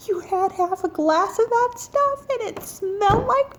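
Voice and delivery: very high, incredulous squeal; freaking out. Retold or imitated. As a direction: squeal it in mock horror. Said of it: "so I'm freaking out".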